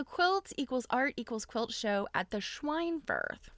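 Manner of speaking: ordinary talk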